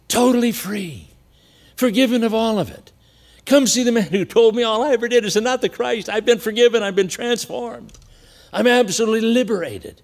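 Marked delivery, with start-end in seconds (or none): 3.4-10.0 high, loud joyful announcing; elated woman telling the town; joyful proclamation. Retold or imitated. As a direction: proclaim her freedom to everyone.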